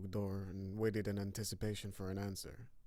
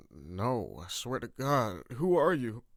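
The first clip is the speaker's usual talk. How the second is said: slow, higher pleading cry; crying out in fear; 5 semitones higher, 9 dB louder, slower than usual. Acted. Seamus pleading with the armed intruders, crying out in fear. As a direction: beg the stranger to believe you. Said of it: "he cried out".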